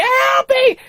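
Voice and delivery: high, loud cry for help; wife stuck in snow, yelling; screaming wife. Retold or imitated. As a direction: shriek for help. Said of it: "she screamed".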